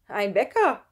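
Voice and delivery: loud, emphatic answer; calling out. A narrator's reading. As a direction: call it across the room. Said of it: "rief Kevin". (laut gerufen)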